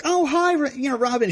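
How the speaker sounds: high, friendly greeting imitating a woman; Rose McIver. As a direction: greet someone sweetly, pitched well up. Imitating the actress greeting him.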